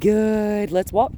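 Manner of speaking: high, loud dog-praise voice; lavish praise. Real, not acted. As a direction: cheer her on to keep walking. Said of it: "really lavishly praise her".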